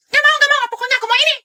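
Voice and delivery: put-on high-pitched whining kid crying for a toy; whiny child. Spoiled kid whining to mom at the store, crying. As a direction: wail like a spoiled child who wants it.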